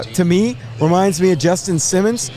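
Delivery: usual speaking voice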